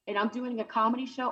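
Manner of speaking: normal talking voice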